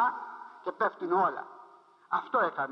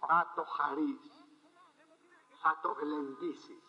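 tender slow promise; 3 semitones lower, 4 dB quieter, slower than usual. Acted. Preacher softens, slows.

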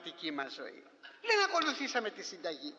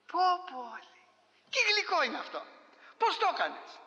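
delighted surprise; 9 semitones higher than usual. Retold or imitated. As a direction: gasp at how sweet it is. Villager tastes loukoumades in delight.

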